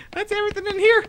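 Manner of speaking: high, squeaky-pitched laughing words; real laughing. Real, not acted. Player done searching the room, real laughing.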